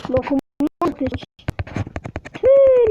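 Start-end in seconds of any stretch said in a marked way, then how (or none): 2.3-2.9 loud frustrated outburst. Real, not acted. Game mishap, sudden outburst.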